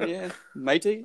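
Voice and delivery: slow Aussie "matey" with a rising end; lightly hammed Australian "Yeah, matey."; Australian impression. Closing the impression for the host, mock Australian accent. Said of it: "awesome impression".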